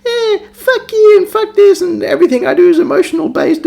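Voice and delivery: very high, whiny mock teenager; bitchy thirteen-year-old. Mocking Panam as a bitchy thirteen-year-old, squeaky brat voice. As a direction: imitate a bratty kid in a squeaky pitch. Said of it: "written by some bitchy thirteen-year-old".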